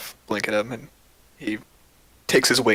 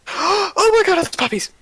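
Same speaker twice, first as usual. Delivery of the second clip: very high, loud delighted squeal; excited squeal; 6 dB louder, slower than usual. Acted. Fairy sees the puppies, ecstatic high squeal. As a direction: shriek with joy at puppies.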